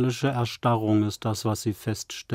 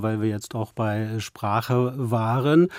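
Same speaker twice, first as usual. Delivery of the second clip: normal talking voice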